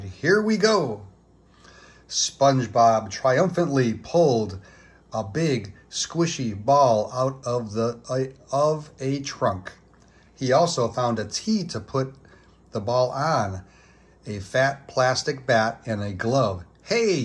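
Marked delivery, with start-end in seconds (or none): 0.0-1.1 triumphant cry. A narrator's reading. SpongeBob digging out his baseball gear, a triumphant cry. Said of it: "he cried". 16.8-17.2 high delighted hey; a happy little yelp of discovery; delighted cry. A narrator's reading. SpongeBob finding his old cap, a delighted high cry. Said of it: "he cried, delighted".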